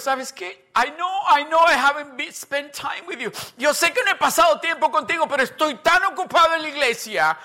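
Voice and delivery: loud, high, hurried brush-off; dismissive excuse. Acted. Busy church worker excusing himself to God, hurried and dismissive.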